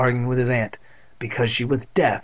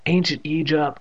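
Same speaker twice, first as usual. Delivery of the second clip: plain reading voice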